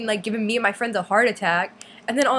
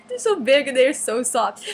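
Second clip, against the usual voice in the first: swooning; 4 semitones higher, slower than usual. Retold or imitated. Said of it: "and I was like".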